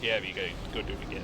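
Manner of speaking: quick offhand quest giver, lightly put on; a hint of a dismissive quest giver. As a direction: rattle it off like a bored NPC. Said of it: "And they're like".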